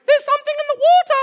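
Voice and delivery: high, fast, loud alarmed shout; cartoon sailor crying out about the whale; excited cartoon cry. VeggieTale sailors spot the whale.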